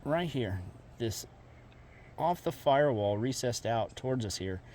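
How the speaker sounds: usual speaking voice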